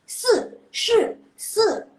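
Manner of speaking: se, Shi, Se each hit high and loud, falling sharply; forceful fourth tones. Shown as a demonstration. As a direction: bark each syllable down like stepping on the gas. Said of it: "kayak ngegas gitu loh".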